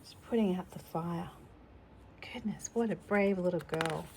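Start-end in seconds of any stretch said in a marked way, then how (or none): none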